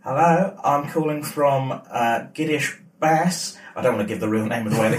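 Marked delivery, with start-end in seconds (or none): 0.0-3.6 slow, hammy Australian accent; mock Australian call centre voice; bad Australian accent. Shown as a demonstration. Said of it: "a really bad Australian accent".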